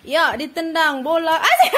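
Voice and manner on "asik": high, loud cheering with laughter; excited laughing cheer. Real, not acted. Child kicks ball, cheering.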